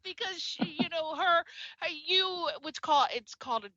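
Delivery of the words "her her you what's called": usual speaking voice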